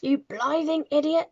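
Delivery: high, loud headmistress insult; angry shout. A narrator's reading. Trunchbull berates Wilfred over times table, shouting. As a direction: roar at a hopeless pupil. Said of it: "shouted the Trunchbull".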